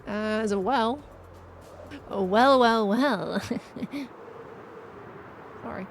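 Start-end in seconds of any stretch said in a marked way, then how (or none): none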